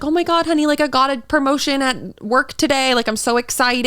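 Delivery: imitating the wife's excited news. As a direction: gush about the promotion like the happy wife. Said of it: "she probably just came home and was like".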